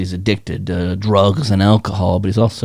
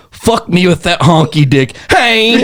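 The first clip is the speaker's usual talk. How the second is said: higher, louder, ending in a laughing shouted Hey; roommate's outburst; 10 semitones higher, 4 dB louder than usual. Retold or imitated. Comic voices rehab roommate watching porn, higher and loud. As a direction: pant it out then yelp the tic. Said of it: "from the top bunk is".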